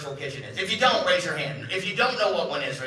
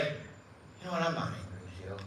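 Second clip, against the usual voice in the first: whispered, softer denial; hushed denial; 13 dB quieter than usual. Retold or imitated. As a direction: drop it to a hush. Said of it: "he's like".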